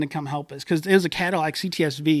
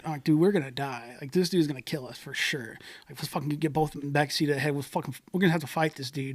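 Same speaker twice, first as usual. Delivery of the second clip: much softer, hushed panicked plotting; scared whisper-talk; 2 semitones lower, 9 dB quieter than usual. Retold or imitated. Friends fear the driver will kill them, much softer. Retold fear in the back seat.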